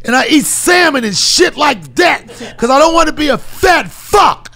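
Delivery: winded, forceful motivational rant in short bursts; hammed-up tough talk at the end of a run; David Goggins impression. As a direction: pant heavily between emphatic phrases. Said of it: "incredible David Goggins".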